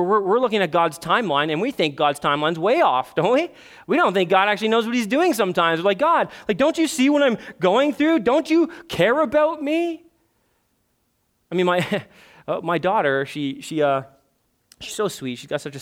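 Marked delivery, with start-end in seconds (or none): 6.0-10.0 high, imploring cry to God; pleading complaint. Acted. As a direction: act out an exasperated plea, building to a slow last question. Voicing believers' complaint to God.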